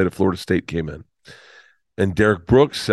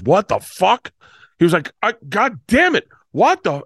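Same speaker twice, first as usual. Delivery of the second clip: much higher, sputtering disbelief; shocked dad; 10 semitones higher than usual. Retold or imitated. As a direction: imitate the dad reacting to the price.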